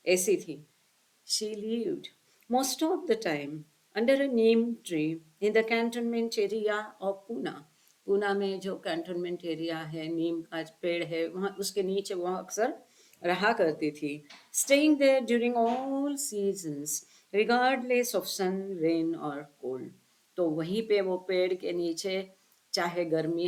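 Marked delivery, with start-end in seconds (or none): 1.3-7.5 slow, careful read of a scripture passage; measured recitation of the text; slow reading aloud. Acted. Reads the English text slowly. 14.5-19.9 drawn-out, careful read of the passage; slow, solemn recitation; very slow reading aloud. Acted. Reads the English text slowly.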